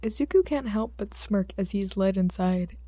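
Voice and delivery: ordinary delivery, nothing marked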